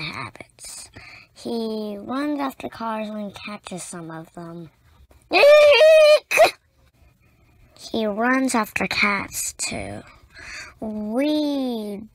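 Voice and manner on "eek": high loud squeal of alarm; startled squeal. Acted. Child reader squeals as Clifford chases cars, shrill. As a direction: shriek it like a startled kid.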